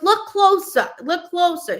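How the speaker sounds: high-pitched, urgent urging; imitated insistent mother. Client's mom telling the psychic to look, high and insistent. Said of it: "She goes".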